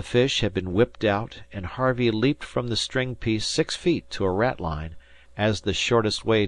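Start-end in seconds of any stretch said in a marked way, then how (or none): none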